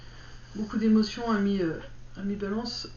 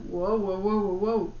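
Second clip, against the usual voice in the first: dry heaving; 2 semitones lower, slower than usual. Real, not acted. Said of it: "j'ai envie de vomir".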